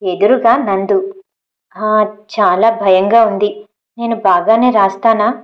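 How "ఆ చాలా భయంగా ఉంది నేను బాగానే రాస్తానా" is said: ordinary delivery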